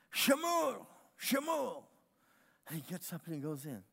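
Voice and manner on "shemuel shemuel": high, calling "Shemuel" with a falling end; Hebrew name, called out. Acted. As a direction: call "Shemuel" the Hebrew way, sheh-MOOL, pitched high. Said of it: "is how he said it".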